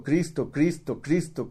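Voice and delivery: ordinary delivery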